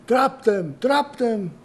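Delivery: high, loud shouted command; yelled out. Acted. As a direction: yell it like a man on the factory floor. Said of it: "some French man yell out".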